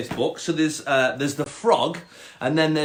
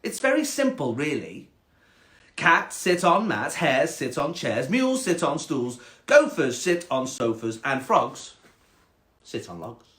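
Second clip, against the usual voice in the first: snobby cat. Acted. Cat explaining the rules to the frog, snobby and measured. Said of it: "quite a snobby cat".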